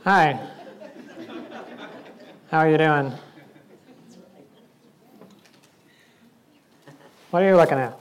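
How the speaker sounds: low, offhand small talk; deadpan casual hello; nonchalant greeting. Acted. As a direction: play it cool, low and casual. Skit of acting casual with plank.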